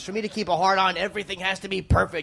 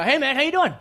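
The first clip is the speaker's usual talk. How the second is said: casual greeting; 8 semitones higher than usual. Retold or imitated. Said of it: "he's like".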